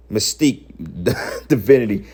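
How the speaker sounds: screen name broken up by a laugh; laughing scorn. Real, not acted. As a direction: repeat the name, cracking up midway.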